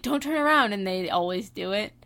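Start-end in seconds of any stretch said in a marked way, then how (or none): 0.0-0.7 mock movie warning. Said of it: "show's like".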